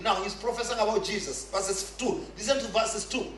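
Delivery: ordinary talk, nothing marked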